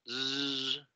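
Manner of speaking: Z sola, larga, zumbando; Z vibrante. Shown as a demonstration. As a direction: haz vibrar la Z y sostenla. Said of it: "como una vibración". (zumbido sostenido de Z)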